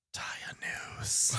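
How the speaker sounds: slow, creepy whispered urging; sinister whisper. Acted. The Thing outside whispers to Blair, slow and sinister. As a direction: whisper it like a lurking monster.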